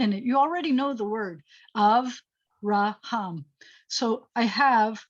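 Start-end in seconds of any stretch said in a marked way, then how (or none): 1.8-3.4 Avraham very slowly, split as Av-ra-ham; syllable by syllable. Shown as a demonstration. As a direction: break Avraham into three drawn-out syllables. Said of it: "break it down into syllables".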